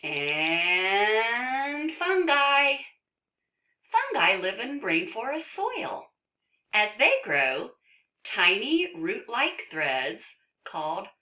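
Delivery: drawn-out, rising headline then lively narration; reading to children. Acted. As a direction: stretch out the title, then narrate brightly. Reads book to children.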